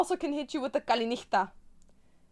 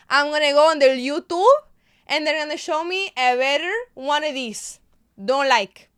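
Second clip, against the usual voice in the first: hammed-up Spanish accent, growing louder; performed Spanish-accented rant, getting more emphatic; 5 dB louder than usual. Shown as a demonstration. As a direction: complain in a put-on Spanish accent. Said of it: "Hit us with Spanish".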